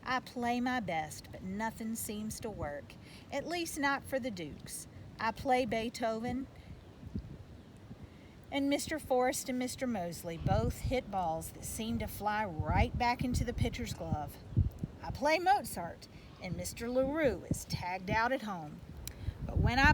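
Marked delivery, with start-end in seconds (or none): none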